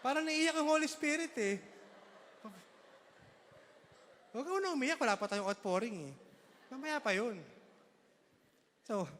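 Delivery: high, fretting over a crying Holy Spirit; fussy worried voice. Acted. Preacher fussing over the crying Holy Spirit, high and anxious.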